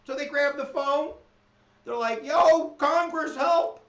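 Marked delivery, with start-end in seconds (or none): 2.2-3.8 high, loud and slow, begging; comic mock-desperate plea; panicked cry for help. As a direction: yell down the phone for rescue. Elites phone Congress in panic.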